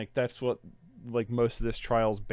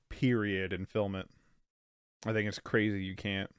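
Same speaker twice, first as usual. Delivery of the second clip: ordinary talk, nothing marked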